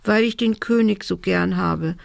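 plain reading voice

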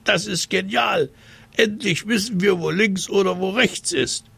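hohe, begeisterte Waldschratstimme; begeisterte Waldschrate. Acted. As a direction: jubelnd wie kleine Waldwesen. Waldis jubeln über Lösung. (hohe Waldis, begeistert)

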